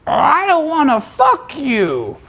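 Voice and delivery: high, slow, dragged-out voice of an ugly guy; mock the troll, drawn out and high; a hammed-up ugly troll turning her down. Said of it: "the ugliest troll in the world can be like".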